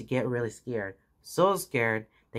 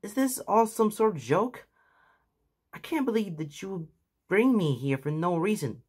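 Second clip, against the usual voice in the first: put-on upset young hero; 8 semitones higher than usual. A narrator's reading. Midoriya upset, disbelieving.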